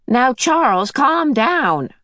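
put-on brisk kindly old woman calming a child; Mrs Whatsit. A narrator's reading.

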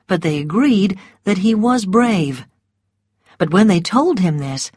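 usual speaking voice

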